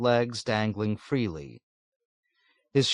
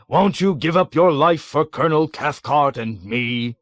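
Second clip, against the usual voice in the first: harsh, higher, louder mimicry of his boss; mock self-righteous; 6 semitones higher, 6 dB louder than usual. A narrator's reading. Deputy mockingly imitating his commander to the captain. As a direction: ape the colonel's pompous harshness. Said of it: "emulating Colonel Cathcart's harsh, self-righteous tone".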